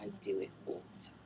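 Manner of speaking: low, fast whisper. Real, not acted. Whispering a joke to her cohost, low and fast. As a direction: rush it out under your breath.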